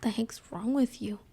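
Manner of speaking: hushed, puzzled mom whisper. Retold or imitated. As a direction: whisper it like a baffled mother. Mom answering her stoned child.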